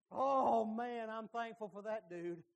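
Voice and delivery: normal talking voice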